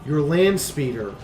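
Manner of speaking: ordinary delivery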